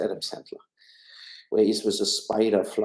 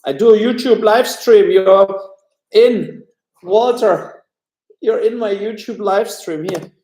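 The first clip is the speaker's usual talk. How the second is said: much higher and louder, calling out; loud high announcing; 12 semitones higher, 12 dB louder than usual. Real, not acted. Father telling Walter he is live on stream, loud calling. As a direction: call over to someone who won't come.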